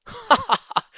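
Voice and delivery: high, loud hearty ha ha ha; hearty laugh. Acted. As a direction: laugh out big and jolly. Mister Fox laughs at feast.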